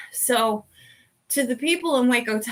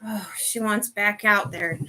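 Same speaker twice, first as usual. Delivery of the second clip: ordinary talk, nothing marked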